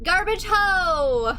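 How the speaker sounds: long, high, loud lookout call. Acted. Boat operator announcing land, long loud call. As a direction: holler it out, stretching the last word.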